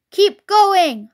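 high, loud order to workmates; shout. A narrator's reading. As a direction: shout across to the other drivers. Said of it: "shouted to Bunce and Bean".

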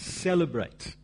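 "celebrate" lifted out at the end; stress on celebrate. Real, not acted. Preacher to congregation on Easter Sunday, stressing "celebrate". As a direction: lean on the word "celebrate". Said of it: "I want to emphasize that word".

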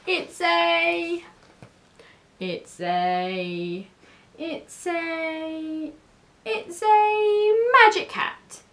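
stalling repeats building to a high, slow reveal; hesitant improvising. A narrator's reading. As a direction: grope for it, then announce it proudly. Keith improvises an excuse for cats.

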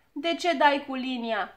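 loud, drawn-out complaint; indignant shouting. A narrator's reading. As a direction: cry out at being hit. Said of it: "începu ea să strige". (strigăt indignat, tărăgănat)

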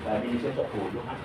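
ordinary talk, nothing marked

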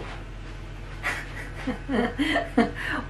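airy disbelieving giggle; breathless laugh. Real, not acted. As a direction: laugh softly at the mess. Sees the failed cake inside.

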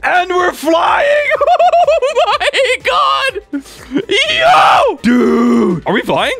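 very high, slow, stretched whoop; thrilled shouting. Real, not acted. Gamer watches the plane lift off, thrilled yelling. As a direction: squeal with astonished delight as it takes off.

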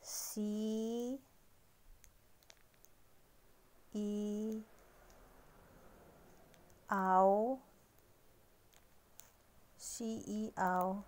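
"si, i, aw" slowly, sound by sound; teacher sounding out "xiao" in parts; spelled-out xiao. Shown as a demonstration. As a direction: break "xiao" into "si", "i", "aw". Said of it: "Kung paano siya basahin".

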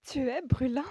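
high, loud, thrilled exclamation; excited. A narrator's reading. Woman realizing his fever is still up, high and excited. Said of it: "reprit-elle tout excitée".

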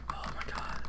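tiny unvoiced gasp and whisper; terrified whisper. Real, not acted. Gamer spotted by an ogre, terrified whisper. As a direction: barely breathe it out.

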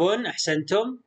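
ordinary delivery

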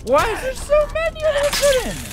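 very high, loud outraged squeal; exasperated shriek. Real, not acted. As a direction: wail at the endless enemies. Overwhelmed by enemies.

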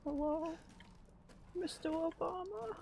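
high, soft, questioning call; timid calling out. Real, not acted. Player calling into an empty house, timid and hushed. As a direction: call softly, drawing out the name.

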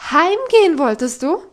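loud, rising on Heimgehen; incredulous question. Acted. Governess demanding an answer from Heidi, loud rising outrage.

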